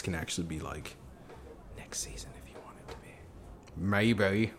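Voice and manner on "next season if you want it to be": whisper. Real, not acted. Aside to players.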